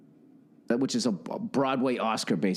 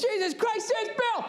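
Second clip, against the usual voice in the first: much higher, put-on voice of an excited old dad; dad impression. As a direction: play your father telling the story on the phone. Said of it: "He goes".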